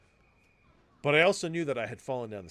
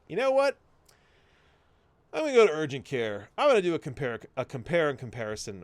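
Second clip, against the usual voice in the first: irritated resolve; 3 semitones higher, 6 dB louder than usual. Retold or imitated. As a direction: snap into a decision out of irritation. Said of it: "irritated that I was like".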